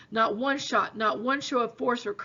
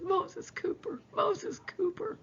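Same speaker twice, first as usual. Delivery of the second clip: higher, softer, slow and hollow; dazed grieving widow; 8 semitones higher, 5 dB quieter, much slower than usual. A narrator's reading. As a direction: say his name as if she can't believe it. Grieving widow repeating husband's name.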